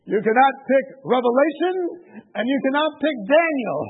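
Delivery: high, loud imitated refusal; emphatic forbidding. Man forbids visitor two books, loud emphatic refusal.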